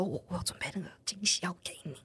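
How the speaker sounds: soft unvoiced whisper of a surprise; whispered secret. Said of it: "他就很兴奋地跟我说".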